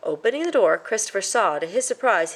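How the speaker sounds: usual speaking voice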